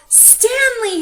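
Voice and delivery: very high, loud cheer. A narrator's reading. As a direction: cheer his name. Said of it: "his friends cheered".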